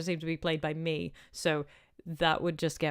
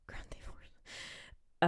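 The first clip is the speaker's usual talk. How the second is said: slow breathy whisper to herself; 9 dB quieter, much slower than usual. Real, not acted. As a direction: murmura el título como un secreto. Muttering a game title aside.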